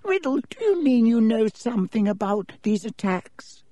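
put-on high, thin old wizard's voice, grave; a feeble headmaster explaining gently; frail old headmaster. Dippet explaining to Riddle why he must leave, high frail voice.

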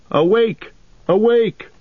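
high, ringing call to wake; alarm cry. Acted. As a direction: sound the alarm, pitched far up. Horn-call alarm cried in the night.

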